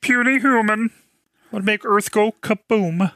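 high, loud alien overlord voice; booming arrogant alien overlord; Marvin the Martian. Retold or imitated. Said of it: "I was thinking Marvin the Martian".

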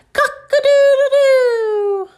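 high, loud, long crow; drawn-out high rooster call. Acted. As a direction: crow like a rooster, holding the last note. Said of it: "sound like a rooster".

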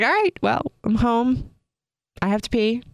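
chirpy announcement. Retold or imitated. Announcing coming home to husband.

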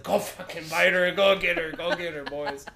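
loud, high, mock attack command; urging dogs on. Owner sending his dogs after the nun, loud excited urging. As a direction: sic them on her, loudly.

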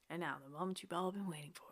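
fast hushed announcer whisper; dramatic whisper. Acted. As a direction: whisper it like a big reveal. Announcing the next topic dramatically.

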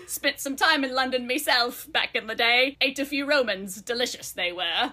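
slow, hammed-up Cockney bird; bad Cockney accent. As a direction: drawl it out in a badly faked Cockney. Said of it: "without my bad accent".

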